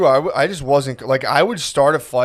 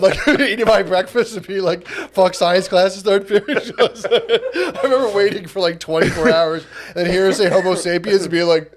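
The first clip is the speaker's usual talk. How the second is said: laughing hard; 4 semitones higher than usual. Real, not acted. Comic telling friend a childhood memory, helpless laughter. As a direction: struggle to talk while cracking up.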